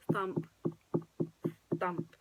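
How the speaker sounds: ordinary delivery, nothing marked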